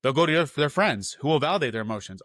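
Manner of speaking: ordinary delivery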